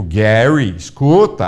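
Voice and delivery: Gary stressed loud and falling; imitating the prophet's emphasis; emphasized name. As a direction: lean hard on Gary. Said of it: "nessas ré enfatizadas".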